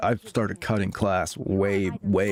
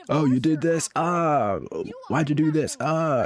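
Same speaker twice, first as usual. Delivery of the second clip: higher mock parent, accusing; nagging parent; 8 semitones higher than usual. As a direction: pester like a parent who caught you. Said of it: "somebody there monitoring what you're doing".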